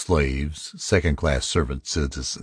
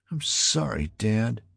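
put-on high, soft child's mumble; muttered apology; 7 semitones higher, 5 dB quieter than usual. A narrator's reading. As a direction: mutter it small and sorry, as the son. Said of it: "he muttered".

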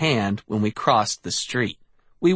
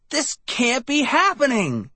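high panicked shriek; 11 semitones higher than usual. A narrator's reading. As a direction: shriek it in disbelief. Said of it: "I shrieked".

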